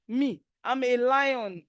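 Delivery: slow, proud declaration; defiant boast. Real, not acted. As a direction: plant your feet and own it. Defiant boast against racism.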